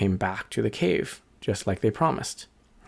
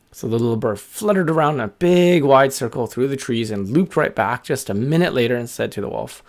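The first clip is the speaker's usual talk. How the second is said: plain reading voice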